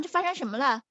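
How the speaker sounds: ordinary delivery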